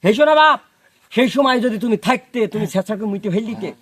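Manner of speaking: usual speaking voice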